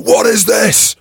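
high, loud shout with the second slow; retold coach yelling in rage; furious shout. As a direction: roar it across the breakfast room. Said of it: "He's like".

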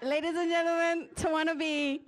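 shouted name, announcer style. Acted. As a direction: call her name out to the crowd. Host shouting introduction.

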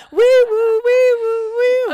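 high loud wee woo siren; siren imitation. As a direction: wail it like a police siren. Mock siren after disgust.

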